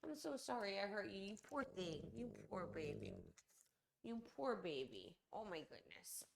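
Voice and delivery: quiet pity; soft consoling. Real, not acted. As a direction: cradle the hurt pup with words. Consoling the dog softly.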